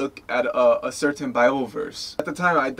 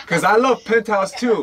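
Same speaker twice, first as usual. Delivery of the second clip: laughing; 7 semitones higher, 6 dB louder than usual. Real, not acted. Laughing excitedly about the show.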